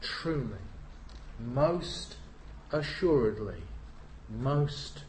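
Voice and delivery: slow, hushed, solemn words; solemn reading. Acted. Preacher opening the sermon, hushed and solemn. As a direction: let each word fall heavy and slow.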